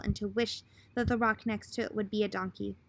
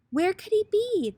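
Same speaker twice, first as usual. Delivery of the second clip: higher and louder, anxious; worried parents; 8 semitones higher, 4 dB louder than usual. Acted. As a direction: ask it like frantic parents.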